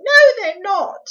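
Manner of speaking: high, loud, desperate protest; boy's quick protest. A narrator's reading. Small boy defending the borrowers, high frightened cry. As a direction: cut in high in alarm. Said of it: "he put in quickly".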